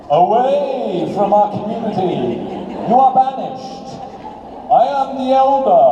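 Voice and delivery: a hint of a pompous elder; elder's decree. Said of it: "these guys that go".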